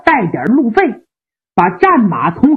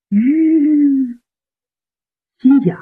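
ordinary delivery, nothing marked

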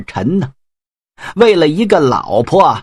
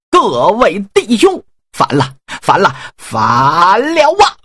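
stern rebel cry; 4 semitones higher than usual. Acted. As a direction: proclaim the rebellion to your brothers. General declares rebellion.

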